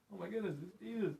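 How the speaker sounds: high, amazed mock exclamation; excited cry. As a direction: act out the disciples' sudden recognition. Disciples spotting Jesus from boat.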